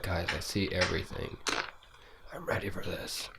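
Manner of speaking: quiet, hushed mock revelation; limitless-pill character. Friend retelling a movie scene, hushed mock awe. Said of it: "you took those pills with [name] and, like".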